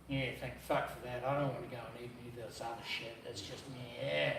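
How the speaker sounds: low, quiet retold grumble; dry unimpressed reply. Answering mate's breakfast offer on Christmas, dry and unimpressed. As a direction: grumble it low and under your voice.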